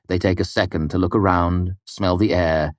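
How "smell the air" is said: plain reading voice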